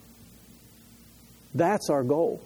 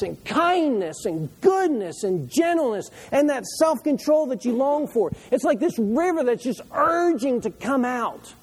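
high, raised voice with long stretched key words; impassioned preaching; 7 semitones higher, 3 dB louder than usual. Real, not acted. Preacher urging congregation about rivers of living water, impassioned and high.

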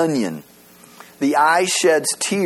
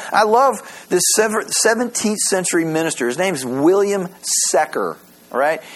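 ordinary talk

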